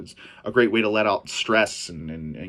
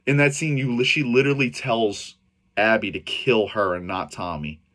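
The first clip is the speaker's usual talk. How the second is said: usual speaking voice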